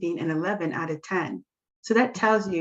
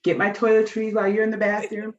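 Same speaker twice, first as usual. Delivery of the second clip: ordinary talk, nothing marked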